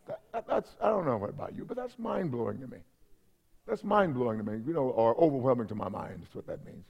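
quietly mind-blown, almost to himself; hushed awe. Real, not acted. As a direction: drop the voice right down in real wonder. Awed at God living in him.

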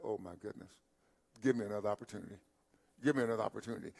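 low, soft voice of regret; quiet regretful plea. Acted. Voices someone regretting a missed chance.

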